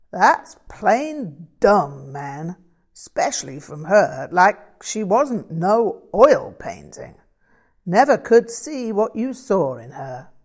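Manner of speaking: dismissive bluesman buddy talk; blunt American bluesman. Acted. Voices the character Frank.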